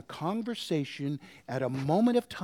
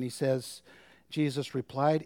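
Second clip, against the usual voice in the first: normal talking voice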